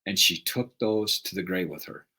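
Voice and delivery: normal talking voice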